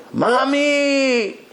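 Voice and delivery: put-on high, ringing call of a boy seeing his mother; boy's cry of joy. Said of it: "the boy gave such a shout".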